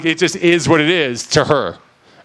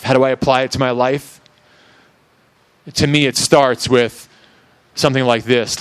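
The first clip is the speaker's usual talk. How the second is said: usual speaking voice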